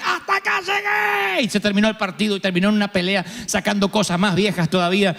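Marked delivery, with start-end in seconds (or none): 0.0-1.4 high, slow, shouted breaking point; mock explosion. Friend retelling how he finally exploded at his wife, high drawn-out mock shout. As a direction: blow up, dragging each word out.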